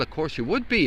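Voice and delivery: normal talking voice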